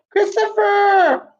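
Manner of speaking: Christopher called out high and slow; calling out. Real, not acted. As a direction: call the name across the room. Calling out a child's name.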